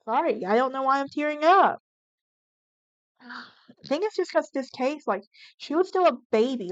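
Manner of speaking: fighting back tears while talking about the victim; tearing up. Real, not acted. Host tearing up over a young victim, voice catching.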